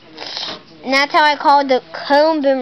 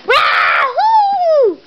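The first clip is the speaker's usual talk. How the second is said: flying-toy whoop; gleeful shout; 12 semitones higher than usual. Pretend. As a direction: cheer as you take off. Toy bird flies.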